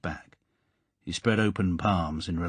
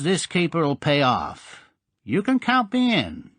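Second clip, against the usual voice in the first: smooth gangster; 7 semitones higher, 4 dB louder than usual. A narrator's reading. Mister Strap charming Goldfinger, smooth and high. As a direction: charm him into the deal. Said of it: "He said smoothly".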